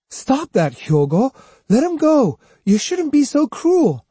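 loud high pleading scold; urgent protest. A narrator's reading. Otsu pleading with Hyogo to release the boy, loud. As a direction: stop him right now.

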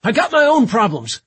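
loud, high, strained retort; grunted retort. A narrator's reading. Warrant officer answering Katz mid-attack, loud harried retort. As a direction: shout it back under pressure. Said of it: "the warrant officer grunted".

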